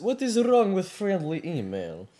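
protesting in a put-on Russian accent; insistent, indignant Russian accent. Vladimir defending the friendly email, Russian accent.

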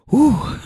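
high, exhaled whew of relief; mock relieved whew. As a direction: let the tension out in one acted whew. Man's body relaxes in relief.

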